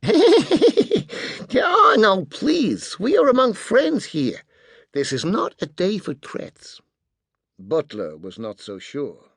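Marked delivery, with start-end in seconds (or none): 0.0-6.8 high-pitched, showy placating voice; oily American host, pitched way up; brash American businessman. A narrator's reading. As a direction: wave the tension away in a high voice. Spyro laughingly soothes the rival bodyguards.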